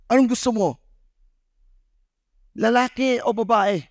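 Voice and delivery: high, teasing quiz voice; teasing host. Retold or imitated. Host quizzing the son at the party, high and teasing. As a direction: tease the boy with a choice.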